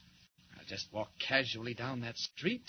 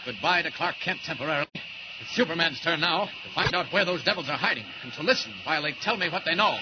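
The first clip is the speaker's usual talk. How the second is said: heroic declaration; 3 semitones higher, 9 dB louder than usual. Acted. Superman takes over, heroic declaration.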